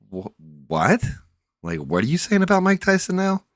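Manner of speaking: high-pitched, baffled disbelief; mock-baffled high voice; incredulous. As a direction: replay a stunned double take, voice shooting way up. Retells own baffled reaction to claim.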